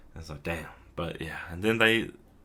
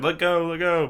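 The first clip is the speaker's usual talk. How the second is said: mock high protest; 9 semitones higher, 4 dB louder than usual. Chidori voiced protesting.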